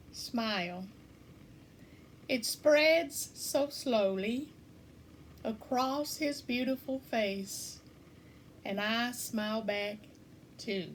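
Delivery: measured haiku recital with long pauses between lines; poem reading. Acted. Poet reading own haiku Smile to listeners, measured poem reading. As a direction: read it slowly, letting the voice sink softly at the end.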